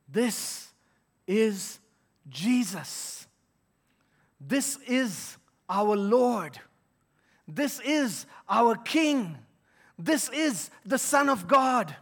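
very slow, each phrase weighed; slow solemn sermon climax; solemn proclamation. Acted. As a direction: declare each title slowly and firmly.

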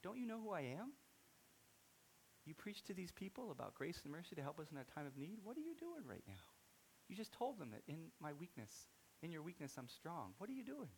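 a father gently calling his son back; voice of God. Retold or imitated. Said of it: "I just hear God said".

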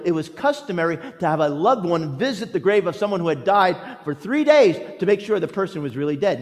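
ordinary talk, nothing marked

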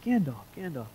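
lightly voiced hobbit, high and puzzled; a hint of a fussy little hobbit recalling the fireworks; Bilbo voice. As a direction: play Bilbo half-remembering an old friend. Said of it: "Bilbo's like".

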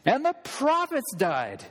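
mock him with chest puffed out; scornful Pharisee rising in pitch. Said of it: "chests all puffed out".

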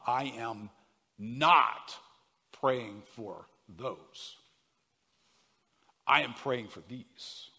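a slow read with not hit hard; stress on not. Shown as a demonstration. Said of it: "that word not".

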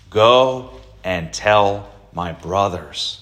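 "Go and tell my brothers", slowed, each word weighed; slow deliberate stress. Shown as a demonstration. Preacher showing how Jesus says it, slow and stressed. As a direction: draw it out so they hear it. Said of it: "notice how he says it".

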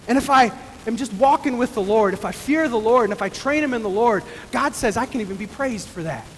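usual speaking voice

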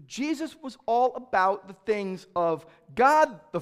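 loud, high, pressing sermon voice; forceful preaching. Acted. Preacher voices the anguish of Jesus' parents, loud preaching. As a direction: drive the point home from the pulpit.

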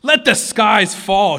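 high, loud, slow shriek. A narrator's reading. Prince shrieking defiance at Aslan, loud. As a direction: hurl it at the sky. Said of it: "shrieked Rabadash".

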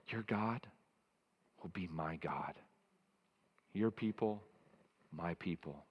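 soft, hushed vow of loyalty; quiet heartfelt pledge; sincere vow. Pretend. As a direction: murmur it like a solemn personal promise. Congregant voicing Ruth's vow.

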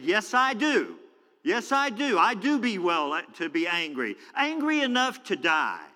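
high, emphatic angry retort; angry, not holding back. Acted. Jonah answers God on his right to anger, defiant outburst. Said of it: "Jonah's not holding back".

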